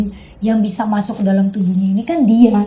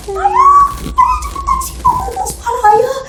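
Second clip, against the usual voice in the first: very high sobbing scream for father; shrill tearful plea, rushed; terrified crying call. Retold or imitated. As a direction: cry out in panic. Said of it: "gue teriak lagi, gue manggil ayah".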